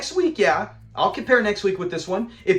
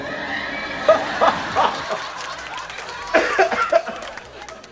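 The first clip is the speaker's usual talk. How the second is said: breathless wheezing laugh, high; cracking up, can't stop; helpless laughter; 10 semitones higher than usual. Real, not acted. Host laughing hard at the clip's punchline.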